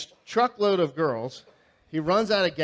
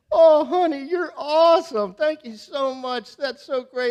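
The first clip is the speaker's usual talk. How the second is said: much higher, louder gushing thanks; gushing wife; 11 semitones higher, 8 dB louder, slower than usual. Pretend. Imagined wife texts her husband, gushing and higher. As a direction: play the delighted wife.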